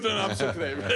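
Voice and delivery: quoting the joke with a laugh. Retold or imitated. Man jokes about his name.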